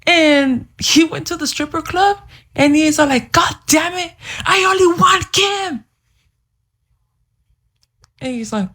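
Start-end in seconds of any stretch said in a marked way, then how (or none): 3.3-5.9 high, hammed-up yell; imitated exasperated outburst; frustrated shout. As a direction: shout it like a lovesick tantrum. Acted outburst of the man.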